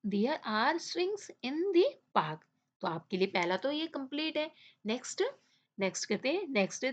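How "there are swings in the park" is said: very slow, word-by-word read-aloud; teacher reading a sentence very slowly to the class; slow dictation. Shown as a demonstration. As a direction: spell it out slowly for young students. Teacher reads answer slowly.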